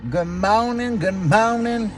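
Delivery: high, bright-sounding good morning; singsong greeting. Real, not acted. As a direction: sing out the greeting. Vlog intro greeting.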